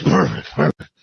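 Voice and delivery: a hint of a low schemer; Levy. Sleazy man approving the girl's answer, in a lightly put-on low voice. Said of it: "And Levy goes".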